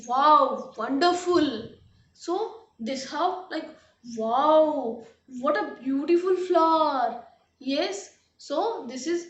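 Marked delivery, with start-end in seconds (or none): 0.1-1.7 loud, excited burst of amazement; sudden feeling exclamation. Shown as a demonstration. Teacher showing students exclamation sentences, excited and loud. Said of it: "then our voice goes higher". 4.2-7.2 loud, admiring exclamation; sudden feeling exclamation. Shown as a demonstration. Teacher demonstrating sudden feeling to students, excited and loud. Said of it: "we are expressing a sudden feeling".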